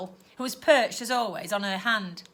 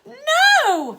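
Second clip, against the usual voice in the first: much higher and louder cry; protesting shout; 10 dB louder than usual. Acted. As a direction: refuse outright. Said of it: "protested Stella".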